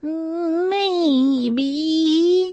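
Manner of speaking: high, very slow worried voice; worried character voice. A narrator's reading. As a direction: draw it out with worry.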